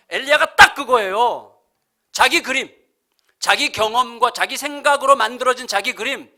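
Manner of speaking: ordinary talk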